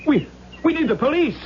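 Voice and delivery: high, urgent call for help; alarmed call. Acted. Actor calling police to the body, high and alarmed. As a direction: shout for the officer, shaken.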